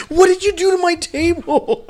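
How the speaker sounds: high-pitched playful wail; mock outrage. Co-host confronting friend over the table, mock outrage. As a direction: wail it in pretend horror.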